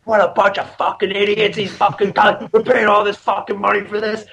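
high-pitched imitation of a ranting dad; outraged dad. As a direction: rant in disbelief as an angry dad. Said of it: "So my dad is me".

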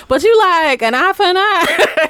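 high, loud, through laughter; amused teasing. Real, not acted. Counselor teasing a mother about punching back, laughing. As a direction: tease a friend, cracking up.

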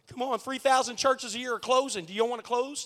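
normal talking voice

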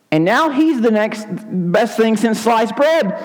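very high, exaggerated needling; rubbing it in. Townspeople tell John a carpenter has replaced him, high-pitched goading. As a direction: stretch the key words in high mock outrage. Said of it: "talking like that sometimes to me".

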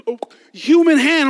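normal talking voice

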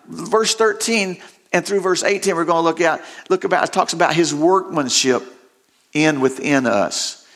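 ordinary talk, nothing marked

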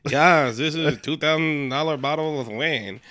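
hammed-up wandering accent, laughing through it; [name]'s put-on accent. [name] showing off pricey wine, comic fake accent. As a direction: play a pompous host in a mock accent. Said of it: "I don't know where my accent went".

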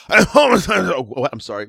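normal talking voice

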